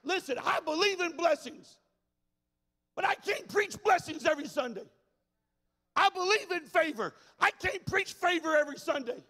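loud, high, shouted sermon lines; full-force preaching. Acted. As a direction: preach it like the church is on fire.

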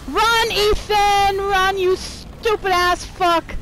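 high, loud, drawn-out urging; frantic yelling. Real, not acted. Player urging Ethan to run, frantic yelling. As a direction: scream at him to get moving. Player yells at own character.

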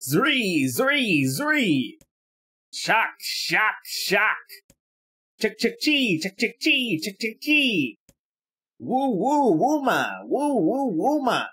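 high, chirping imitation of insect noises; insect calls. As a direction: chirp and buzz like a forest full of bugs.

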